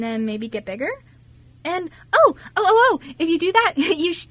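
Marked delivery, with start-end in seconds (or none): 1.6-4.3 excited. Acted. Eager idea for his travels.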